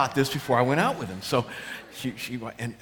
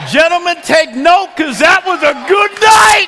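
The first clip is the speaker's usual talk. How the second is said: high, full-volume exultant yell; triumphant shout; 17 dB louder than usual. Acted. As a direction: holler the punchline to the men. Triumphant shouted punchline to men.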